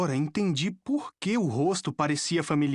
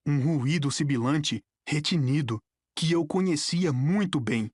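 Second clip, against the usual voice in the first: ordinary delivery, nothing marked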